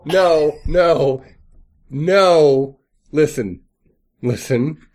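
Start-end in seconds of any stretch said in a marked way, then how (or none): none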